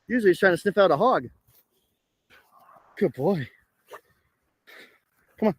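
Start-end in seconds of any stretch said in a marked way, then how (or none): none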